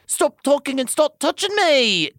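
high, insistent command; ordered. A narrator's reading. Tamlin cuts off the lover's apology, ordering. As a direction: order it sharply, pitch way up. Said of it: "Tamlin ordered".

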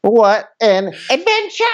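high, slow, gushing "What an adventure!"; mock gushing guest. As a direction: ham up the thrilled guest, stretching it out. Mocking guest's excursion excitement.

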